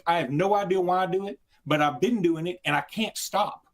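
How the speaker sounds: normal talking voice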